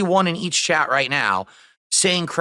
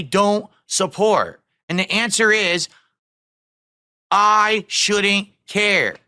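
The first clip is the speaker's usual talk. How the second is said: wild unhinged ranting; 3 semitones higher, slower than usual. Real, not acted. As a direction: pound each word home. Escalating rant at complainers.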